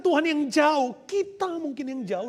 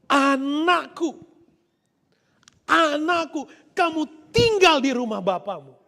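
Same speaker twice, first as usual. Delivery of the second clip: loud, insistent, drawn-out anakku; emphatic father; 6 dB louder than usual. Acted. Father insisting to his son, loud and firm. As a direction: press the point home. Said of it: "Bapak pertegas itu".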